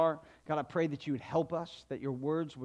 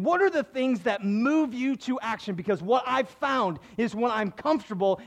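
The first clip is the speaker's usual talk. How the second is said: louder, higher, driving sermon delivery; full-force preaching; 6 semitones higher, 5 dB louder than usual. Acted. Pastor urging the congregation to pray personally, loud and fervent. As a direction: push it out like a pastor building the point.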